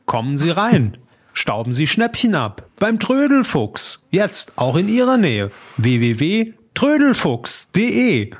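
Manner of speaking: high, slow radio jingle voice; sing-song announcer selling a shop; chipper 1950s ad. Pretend. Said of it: "Das ist der, der Radiospot".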